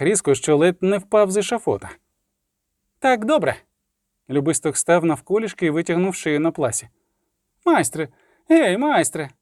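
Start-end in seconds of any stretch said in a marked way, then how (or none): 3.0-3.6 a higher voice for the poet; lightly voiced poet. A narrator's reading. Poet yielding at the block, lightly high-voiced. 7.6-9.3 high poet hailing the executioner; mild calling out. A narrator's reading. Poet at the block calling the executioner, mild hail.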